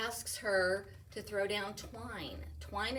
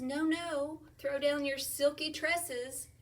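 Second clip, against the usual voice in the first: higher and louder, slow insistent call; prince calling up; 8 semitones higher, 5 dB louder, slower than usual. Acted. Prince calling to Rapunzel in the tower, loud and pleading. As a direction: call up to the tower again, a bit impatient. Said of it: "He says".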